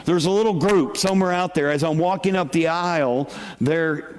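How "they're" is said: ordinary delivery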